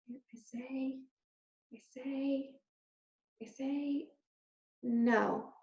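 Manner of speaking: soft, faltering whisper. A narrator's reading. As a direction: barely get the words out. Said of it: "whispered Despero".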